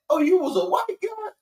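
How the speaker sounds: high-pitched incredulous question; mock surprise. Retelling a movie scene to the group, mock surprised. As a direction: exaggerate the surprise, voice way up.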